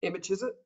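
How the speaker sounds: "Emma Chiswick" softly run together, rising; Aussie slang demo. Shown as a demonstration. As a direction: prompt a repeat of Australian "Emma Chiswick". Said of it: "Can you say that?".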